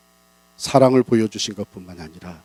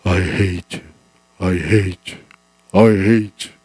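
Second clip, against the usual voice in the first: distant echo; 3 semitones lower, much slower than usual. Acted. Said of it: "여기저기서 음성이, 소리가 들려오는 거예요".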